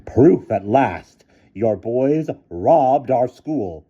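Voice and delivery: put-on slow loud old woman's voice; triumphant. A narrator's reading. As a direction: gloat over the proof. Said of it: "cried Miss Scrimmage triumphantly".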